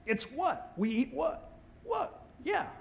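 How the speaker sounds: high, soft incredulous what; baffled what. Acted. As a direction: squeak out the disbelief. Mock baffled about manna.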